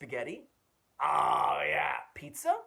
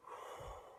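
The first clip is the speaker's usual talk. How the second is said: slow sigh; 12 dB quieter than usual. Shown as a demonstration. Calming herself before temptation.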